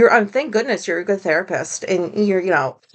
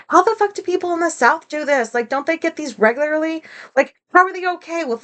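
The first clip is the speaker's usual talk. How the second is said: incredulous; 7 semitones higher than usual. Retold or imitated. Incredulous reaction to tornado alerts.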